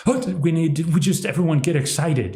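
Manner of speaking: mock the hyped-up crowd; mock excitement. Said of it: "Everyone's, like, addicted".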